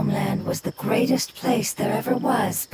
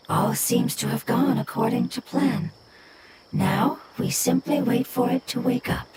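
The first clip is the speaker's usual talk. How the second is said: ordinary delivery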